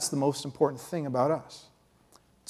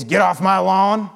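put-on cranky old man yell; 3 semitones higher, 6 dB louder, slower than usual. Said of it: "yelling at all the kids".

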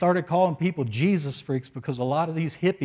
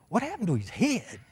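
softer, squeaky-high astonished question; baffled disbelief; 5 semitones higher, 6 dB quieter than usual. Retold or imitated. Pastor quoting a rescue squad man seeing the chopped hair, baffled. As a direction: imitate bewilderment with high, swooping pitch.

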